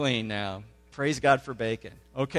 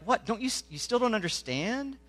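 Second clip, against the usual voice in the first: higher, softer incredulous question; a little frustrated; 7 semitones higher, 6 dB quieter than usual. Retold or imitated. Jesus asking his disciples if they still don't understand, mildly frustrated. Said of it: "he gets a little frustrated".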